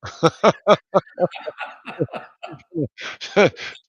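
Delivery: loud high-pitched real laugh; long hearty laugh; big laugh. Real, not acted. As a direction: burst out laughing. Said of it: "Oh, I didn't start talking with an accent".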